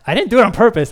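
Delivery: mock indignant I didn't do it; defensive protest. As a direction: protest it loudly, half laughing. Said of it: "I'm like".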